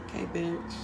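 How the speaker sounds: sassy aside. Retold or imitated. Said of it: "I was like".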